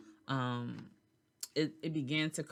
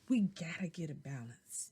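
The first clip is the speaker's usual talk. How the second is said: whispering; 5 dB quieter than usual. Real, not acted. Whispered key message.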